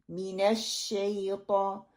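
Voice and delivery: मिनश शैतान slowly with a short clear "mi". Shown as a demonstration. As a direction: say मिनश शैतान with a crisp "mi", not "me". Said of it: "मीम कसरा मी".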